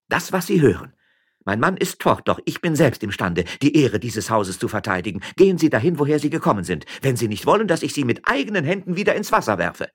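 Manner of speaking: high, defiant woman's voice; indignant miller's wife. Acted. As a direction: defend your house and threaten him.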